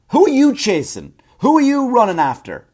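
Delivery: high, loud rhetorical questions; incredulous shouting. Real, not acted. Comic challenging overweight cops, incredulous shout. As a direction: fire off each question in loud disbelief.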